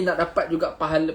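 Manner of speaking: normal talking voice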